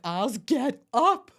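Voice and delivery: loud, slow, urgent wake-up call. Retold or imitated. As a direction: drag him out of bed, slow and loud. Waking husband in labor.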